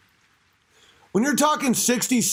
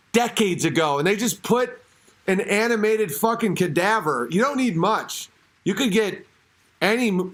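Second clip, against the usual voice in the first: ordinary talk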